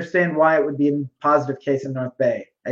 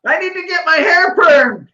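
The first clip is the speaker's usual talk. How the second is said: high, hammed-up wail; mock whiny demand; 10 semitones higher than usual. Imitating a politician wanting the salon, mock wail. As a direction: wail it like a spoiled complaint.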